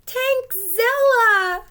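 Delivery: high, loud, slow terrified shriek. Acted. Pencil shrieking at the monster hamster. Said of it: "Pencil shrieked".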